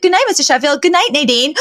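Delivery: high-pitched casual goodnight in passing; imitating an offhand goodnight; breezy goodnight. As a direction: toss off a high, oblivious goodnight. Acted passing goodnight before noticing sister.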